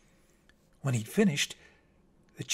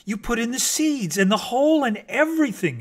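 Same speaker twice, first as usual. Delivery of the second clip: higher, louder delighted exclamation; excited exclaim; 8 semitones higher, 6 dB louder than usual. A narrator's reading. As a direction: burst out in happy wonder. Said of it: "exclaimed the chipmunk".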